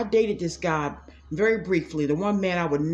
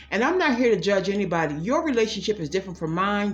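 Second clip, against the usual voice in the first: normal talking voice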